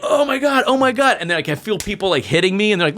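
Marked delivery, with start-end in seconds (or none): 0.0-1.2 high-pitched mock panic; retold panic. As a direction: act out dread, voice lifted high. Clinging to fence in terror.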